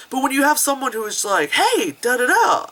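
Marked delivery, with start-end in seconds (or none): 1.5-2.7 higher, slow, eager call; mock excitement. Keen actor delivering his lines, mock excitement. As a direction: imitate an eager performer. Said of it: "I'm so excited".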